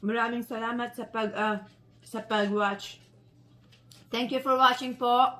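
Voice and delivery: talking with a mouthful; words through a bite of food; mouth full. Real, not acted. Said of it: "I should not talk with my mouth full".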